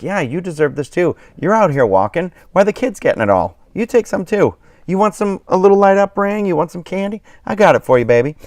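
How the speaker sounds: high, sweet, coaxing voice to grown-up trick-or-treaters; sweet coaxing host. Retold or imitated. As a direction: sweetly urge adults to take candy, then croon "baby".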